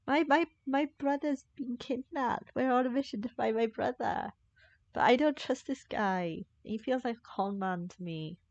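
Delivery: normal talking voice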